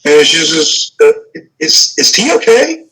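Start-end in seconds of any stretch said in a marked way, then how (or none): 1.6-2.8 worried question pitched higher; concerned shopkeeper Diane. Acted. Diane asks the party if T is okay, worried and higher. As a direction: ask anxiously after the fallen friend.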